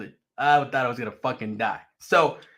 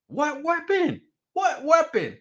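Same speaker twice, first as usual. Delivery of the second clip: very high, incredulous question; exasperated bafflement; 12 semitones higher, slower than usual. Retold or imitated. Man puzzling over deadly weapon charge, exasperated bafflement. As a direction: push the pitch right up, baffled.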